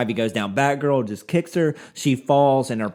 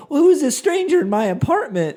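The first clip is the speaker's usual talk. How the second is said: high, loud mock confusion; baffled high voice; 13 semitones higher, 5 dB louder than usual. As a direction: squeak it out, baffled. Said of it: "you're like".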